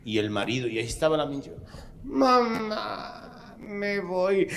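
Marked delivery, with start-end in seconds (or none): 2.0-4.5 mock soap daughter.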